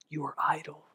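hushed, breathy whisper; whispered accusation. Retold or imitated. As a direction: hiss it like an inner voice. Inner accusing voice, shame.

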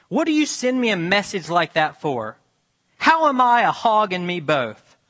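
yelling at God in the barn, furious; angry outcry. A narrator's reading. Woman yelling at God over her hogs, loud and furious. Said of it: "she rumbled".

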